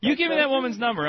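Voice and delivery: loud, high, incredulous outburst; mock outrage. Real, not acted. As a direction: blow up in comic disbelief.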